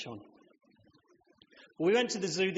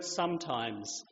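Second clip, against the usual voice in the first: explaining voice; 6 semitones lower, 4 dB quieter, slower than usual. Retold or imitated. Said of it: "explaining difficult things voice".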